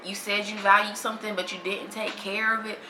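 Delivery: ordinary delivery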